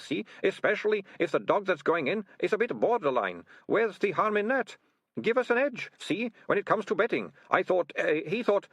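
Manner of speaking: high flustered stammering excuses; flustered ratcatcher. A narrator's reading. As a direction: trip over your own words, high.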